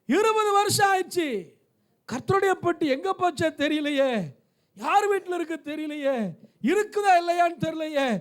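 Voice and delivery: high-pitched plaintive wondering; acted anxious lament. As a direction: lament as the worried searchers would, phrase after phrase. Acts out the searchers' worried lament.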